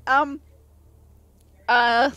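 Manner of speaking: usual speaking voice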